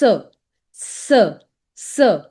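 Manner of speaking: "S" said as an isolated "suh"; CE sound. Shown as a demonstration. Teacher isolates the CE sound, short suh. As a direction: say "S" as the sound suh, not the letter name. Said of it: "it gives a sound of S".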